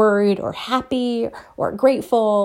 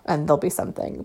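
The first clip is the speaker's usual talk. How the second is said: ordinary talk, nothing marked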